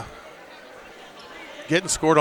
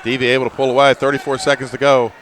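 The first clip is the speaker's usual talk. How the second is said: normal talking voice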